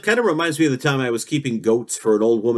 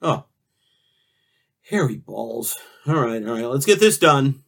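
ordinary talk, nothing marked

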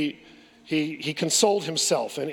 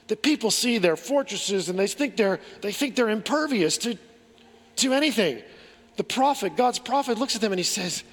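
normal talking voice

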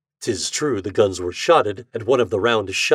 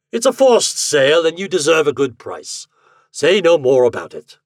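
louder, higher, breezy gentleman; hearty, gentlemanly insistence; genial gentleman captain; 6 semitones higher, 7 dB louder than usual. A narrator's reading. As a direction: wave off thanks in a lordly way.

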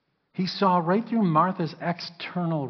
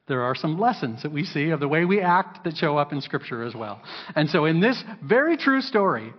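ordinary talk, nothing marked

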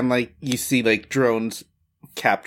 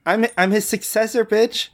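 higher-pitched, bratty comeback; high, snotty villain retort; sassy villain; 9 semitones higher than usual. Acted. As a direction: put the heroes in their place as villain. Villain snaps back at the hero.

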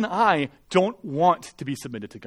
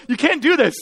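higher, louder retold scolding; indignant passerby; 8 semitones higher, 5 dB louder than usual. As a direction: protest like an appalled onlooker. Acted passerby scolding the drivers.

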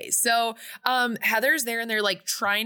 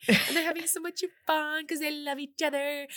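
ordinary talk, nothing marked

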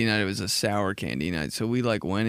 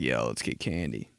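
much lower, mumbled and drowsy; sleepy stoner voice; 12 semitones lower, 6 dB quieter than usual. Retold or imitated. Roommate and I planning a candy night, sleepy low mumble. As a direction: talk like you just woke up. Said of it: "exactly how we talk".

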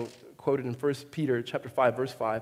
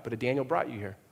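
normal talking voice

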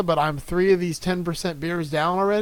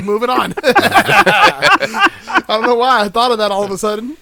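real laughter breaking through, louder and higher; laughing; 5 semitones higher, 9 dB louder, slower than usual. Real, not acted. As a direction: crack up while talking, stretched out by laughs. Cracking up at own tangent.

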